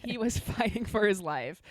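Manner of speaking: laughing while repeating it; giggling through the words. Real, not acted.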